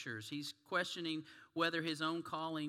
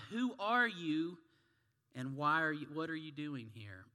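barked out. Acted. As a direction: bark it like a sentry. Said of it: "the soldier barked out".

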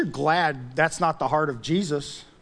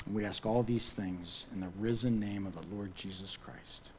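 subdued, earnest prayer, sinking softer toward the amen; closing prayer; 4 semitones lower, 5 dB quieter than usual. Real, not acted. Pastor praying to Jesus for courage to witness, hushed prayer. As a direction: lead the room quietly in prayer.

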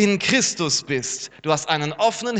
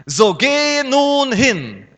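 booming, slow divine command; commanding God; 5 semitones higher, 7 dB louder, much slower than usual. Acted. Preacher voicing God sending Moses, slow booming command. God's command read aloud.

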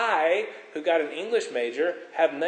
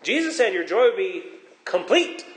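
ordinary delivery

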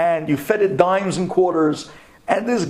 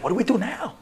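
panicked friends; 10 semitones higher than usual. Retold or imitated. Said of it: "they're looking at each other".

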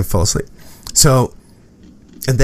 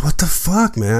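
mock hurt disbelief; 5 semitones higher than usual. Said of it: "I'm like".

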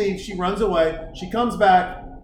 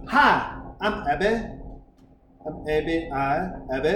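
put-on Valley girl accent; 6 semitones lower, 4 dB quieter than usual. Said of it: "She's a Valley girl".

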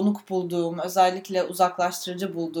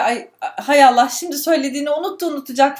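higher, louder, fretful aside; endişeli iç ses; 6 semitones higher, 7 dB louder than usual. Acted. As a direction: voice a worried "oh no" thought, pitched up. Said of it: "endişe yaşıyorum". (telaşlı, endişeli bir iç ses)

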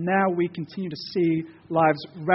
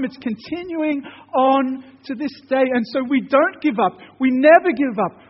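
louder, driving preacher's build, never giving up; preaching at full force; 7 semitones higher, 8 dB louder than usual. Acted. Preacher urging his church to keep praying, fervent and loud. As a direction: urge the church on with fervour.

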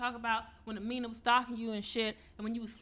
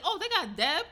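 high, loud retold surprise; surprised reaction; 7 semitones higher, 9 dB louder than usual. Telling a friend who joined the panel, loudly surprised. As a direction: act out spotting an unexpected guest.

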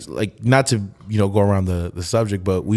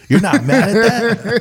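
surprised; 11 semitones higher, 4 dB louder, slower than usual. Retold or imitated. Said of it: "I was just like".

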